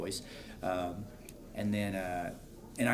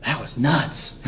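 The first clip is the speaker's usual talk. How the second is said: excited; 6 semitones higher, 6 dB louder, much slower than usual. Retold or imitated. Reacting to Code Geass season finale.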